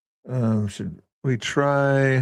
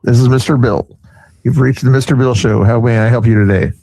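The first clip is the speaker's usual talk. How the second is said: booming radio host phone greeting, much louder; loud show host; 9 dB louder than usual. Pretend. Host answers call show-style.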